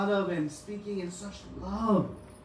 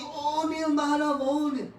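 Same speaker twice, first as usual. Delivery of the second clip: much higher, slow ecstatic call; joyful cry; 10 semitones higher than usual. Retold or imitated. Devotees running to the log hail Nilmadhav, ecstatic high cry. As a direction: run toward him crying out.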